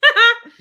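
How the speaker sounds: high, loud burst of laughter. Real, not acted. Host laughing at going too far, loud laugh.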